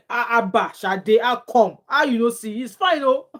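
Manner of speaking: high, loud teasing disbelief; loud mock-indignant exclamation; playful protest. Real, not acted. Playfully protests at a viewer's comment.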